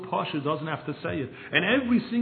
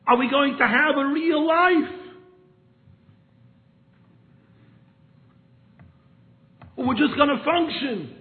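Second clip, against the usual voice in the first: loud, high, pleading rebuke; impassioned rebbe; 10 semitones higher, 8 dB louder, slower than usual. Retold or imitated. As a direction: cry it out like the rebbe scolding his listeners. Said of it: "He says".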